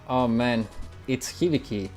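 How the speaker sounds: ordinary talk, nothing marked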